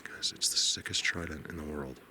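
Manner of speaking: soft whispered awe; whispering, overwhelmed; awed whisper. Real, not acted. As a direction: hush it to the camera in wonder. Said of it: "I'm losing my mind".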